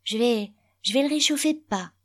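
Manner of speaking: put-on high, hesitant small boy voice; little boy. A narrator's reading. Boy offering to heat father's dinner, high hesitant child voice. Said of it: "dit le petit garçon".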